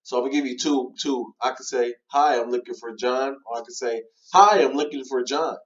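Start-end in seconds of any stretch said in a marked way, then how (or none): 4.3-5.6 bright, louder hello with a lift on Hi; excited greeting. Shown as a demonstration. Cold caller greeting a seller, excited. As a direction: sound thrilled to reach him. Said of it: "one had more excitement".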